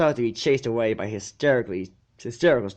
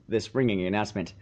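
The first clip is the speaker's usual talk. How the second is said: usual speaking voice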